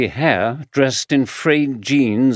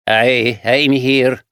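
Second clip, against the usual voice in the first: lightly voiced rough Glaswegian man; gruff Glaswegian drifter answering; Glaswegian accent; 4 dB louder, much slower than usual. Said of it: "he said in a Glaswegian accent".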